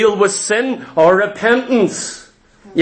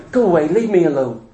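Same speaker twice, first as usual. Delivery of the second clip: low, quick, half-hearted shooing; timid, ineffectual dismissal; feeble plea; 5 semitones lower, much faster than usual. Acted. Preacher mimics someone meekly shooing a barking doggy, feeble plea. Acts out weak plea to dog.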